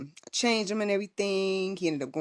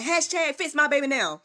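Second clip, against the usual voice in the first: sassy demand; 9 semitones higher than usual. Retold or imitated. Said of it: "and I said".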